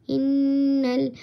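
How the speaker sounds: normal talking voice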